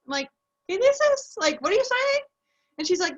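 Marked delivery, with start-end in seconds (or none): 0.7-2.2 loud, high, incredulous question; exaggerated puzzled asking; acted bafflement. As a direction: re-enact total confusion, pitch way up. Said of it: "I honestly didn't know what she was saying".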